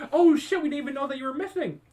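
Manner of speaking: sudden high, loud "Oh shit", then a fast rush; startled realization. Retold or imitated. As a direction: caught off guard, blurt it out quickly. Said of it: "we was like".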